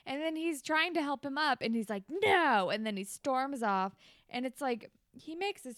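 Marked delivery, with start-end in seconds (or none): 2.1-2.7 sudden raised-voice shout; imitated petulant yell; mock angry refusal. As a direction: blurt it and refuse help. Imitates Craig refusing help, storming off.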